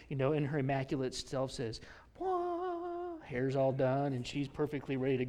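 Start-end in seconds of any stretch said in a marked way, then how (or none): 2.2-3.2 a high, squeaky mock waking sound; mock yawn. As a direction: play Mary waking with a squeak. Mary waking up.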